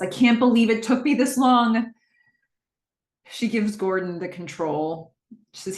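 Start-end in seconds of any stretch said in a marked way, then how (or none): 0.0-1.9 raised, higher-pitched exclamation; excited shout. Acted. Cam shouts on finally finding the Warp Zone, amazed shout. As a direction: call it out louder, amazed at yourself. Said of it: "Cam shouts".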